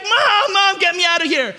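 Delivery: high, loud imitation of a panicking child; hammed-up frightened kid's cry for help; freaking out. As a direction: yell for mom in mock terror. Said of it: "now she's freaking out".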